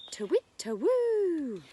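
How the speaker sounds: high, loud, slow owl hoot; teacher imitating an owl for children. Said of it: "An owl says".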